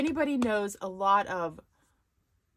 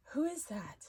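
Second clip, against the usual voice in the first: whispering; 5 semitones higher, 5 dB quieter than usual. Real, not acted. Sudden aside while streaming.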